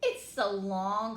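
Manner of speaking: slow, loud taunting; mocking drawl. Classmate Joe teasing Chrysanthemum, slow and loud. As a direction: stretch it out to mock her.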